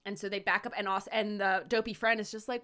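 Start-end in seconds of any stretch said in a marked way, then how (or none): none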